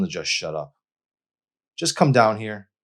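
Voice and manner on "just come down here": demanding. A narrator's reading. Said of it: "her voice demanded".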